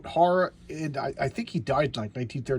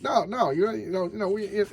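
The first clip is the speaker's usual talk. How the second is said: higher-pitched, mock-proud protest; prideful brush-off; 7 semitones higher than usual. Hero turning down the Familiars' offer of help, mock prideful. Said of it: "he's being prideful".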